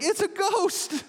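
very high, drawn-out mock wail; hammed-up fright, stretched and high; terrified cry. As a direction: cry out like a spooked disciple. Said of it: "they cried out".

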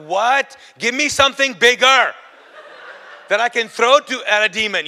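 loud, high comic protest; hammed-up loud complaint; retold indignant outburst. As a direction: shout it in exasperated disbelief. Comic retold argument over tiny Bible.

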